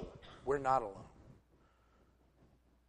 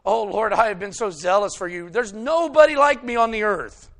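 loud, higher self-pleading; loud protest; 6 semitones higher, 10 dB louder than usual. Retold or imitated. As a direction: cry out your own righteousness. Elijah protests his zeal to God.

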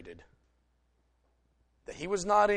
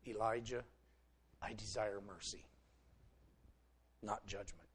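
much softer, lower, gentle; barely above a hush, tender; God's still small voice speaking to Elijah; 6 semitones lower, 15 dB quieter than usual. Retold or imitated. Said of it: "a voice, still and small".

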